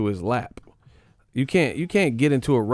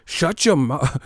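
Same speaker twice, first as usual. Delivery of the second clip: lightly voiced McGregor-like shut up; mock Irish snap; 4 semitones higher, slower than usual. Mocking a fan turning into a McGregor type, light mock snap. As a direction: snap it like a brawler. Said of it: "Turn into Conor McGregor".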